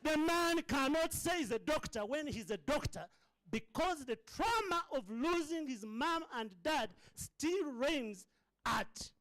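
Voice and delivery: high, full-force preaching cry. Real, not acted. Preacher on a doctor's grief, high and intense. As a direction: break into open preaching. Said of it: "I need to preach".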